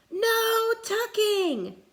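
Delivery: high, slow loud chant; crew cry. Acted. Crew refuses tucking in, loud cry. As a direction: cry it out together. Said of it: "the crew cried".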